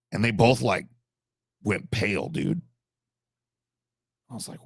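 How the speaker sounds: ordinary talk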